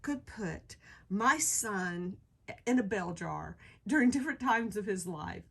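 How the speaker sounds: usual speaking voice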